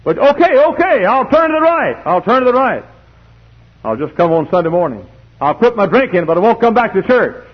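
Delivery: high, loud acted concession; preacher acting a pressed sinner giving in; exasperated bargaining. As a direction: bargain loudly, starting high and easing a little.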